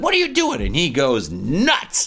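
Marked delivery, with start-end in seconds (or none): 0.0-0.6 high mock shriek. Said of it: "she takes his phone".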